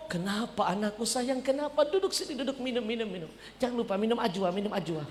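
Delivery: a light doting mother voice. Retold or imitated. Mother soothing her son.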